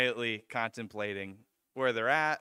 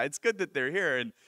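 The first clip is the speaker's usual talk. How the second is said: ordinary talk, nothing marked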